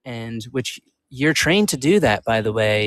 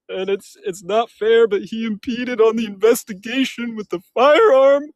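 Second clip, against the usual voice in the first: high, loud, hammed-up mock sobbing; fake crying; 10 semitones higher, 4 dB louder than usual. Mocking her debate opponent with loud fake crying. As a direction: ham up the fake tears to mock him. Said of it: "Can you stop fake crying".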